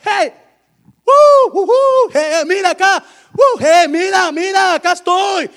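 high, loud hoots and shouts; calling out. Acted. God calling to people lost in pain, hollering. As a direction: holler for attention from someone who won't look.

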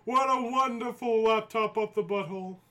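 high gleeful wrestler voice; Matt Hardy impression. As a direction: proclaim it with manic glee. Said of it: "give him the Matt Hardy laugh".

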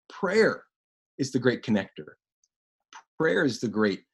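ordinary talk, nothing marked

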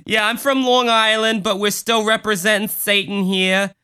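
proud local Satanist in mock Long Island accent. Cult member claiming Long Island roots for Satan, mock Long Island accent. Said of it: "that accent is just hellish".

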